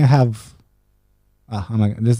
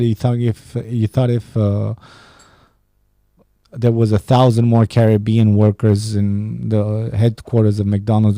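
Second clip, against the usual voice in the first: normal talking voice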